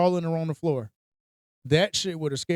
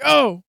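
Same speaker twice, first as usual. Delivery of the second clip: startled yelp; 7 semitones higher, 5 dB louder than usual. Retold or imitated. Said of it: "I was like".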